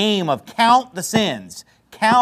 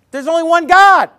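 raised, emphatic retelling, loud and high; hammed-up forceful declaration; louder, embellished; 10 semitones higher, 8 dB louder than usual. Preacher retelling to congregation, getting louder. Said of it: "I'm gonna get a little louder".

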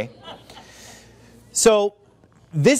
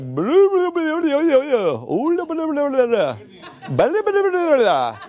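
mock South Indian gibberish; 8 semitones higher, slower than usual. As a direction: rattle off fake Dravidian syllables up high. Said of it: "That was a Dravidian language".

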